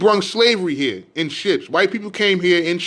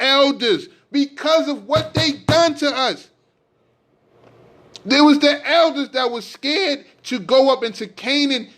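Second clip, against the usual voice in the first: raised, pitch-lifted sermon rant, stretching key words; fiery preaching; 7 semitones higher than usual. Acted. Preacher railing at listeners about their elders, fiery sermon. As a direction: preach it hard at the congregation, voice climbing.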